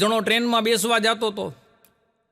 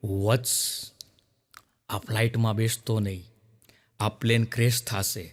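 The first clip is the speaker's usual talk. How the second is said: voice from the sky; 11 semitones lower, 5 dB quieter than usual. Acted. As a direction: boom down like a divine oracle from above. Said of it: "પાછી આકાશવાણી થઈ".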